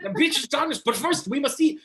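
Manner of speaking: high, hammed-up vampire hunter; Van Helsing. Quoting the film along with a fan, hammed-up high voice. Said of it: "he was hilarious as Van Helsing".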